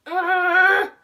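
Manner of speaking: loud, high exasperated yell; short mock scream of frustration; frustrated scream. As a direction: let out a fed-up scream. Frustration at the boy.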